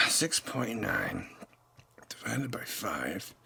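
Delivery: whispered sum to himself; slow whispered calculation. Real, not acted. Redoing the beer math under his breath in a whisper.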